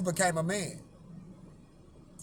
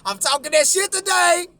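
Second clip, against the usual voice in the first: higher and louder, pumped up; loud laughing brag; 8 semitones higher, 7 dB louder than usual. Real, not acted. Vlogger hyping himself up to viewers, loud. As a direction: shout it out, proud of himself.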